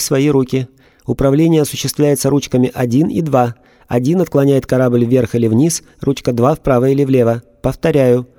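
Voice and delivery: a robot reciting a procedure; emotionless robot. A narrator's reading. Robot gives instructions.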